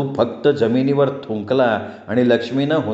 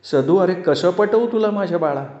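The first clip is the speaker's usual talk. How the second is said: mild wail; 7 semitones higher than usual. A narrator's reading. Weeping mother trying to convince her son, mildly wailing. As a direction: lament to your child with restrained wailing. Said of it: "Lakshmi Tahoe फोडल्यासारखी ओरडली".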